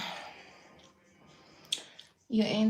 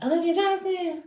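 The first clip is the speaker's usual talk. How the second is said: higher, official name call; guard calling out; 9 semitones higher, 3 dB louder than usual. Retold or imitated. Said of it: "And she's like".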